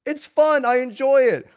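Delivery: loud insistence that it's fun; emphatic. Real, not acted. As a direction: proclaim it proudly. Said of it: "defending his game choice".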